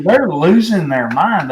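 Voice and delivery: ordinary talk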